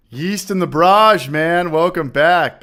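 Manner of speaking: big slow hype greeting; excited greeting. Real, not acted. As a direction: call his name out like a host welcoming a regular. Greeting chat viewer by name.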